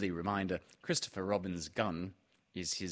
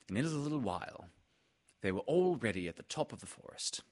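plain reading voice

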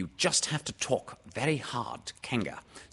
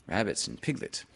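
usual speaking voice

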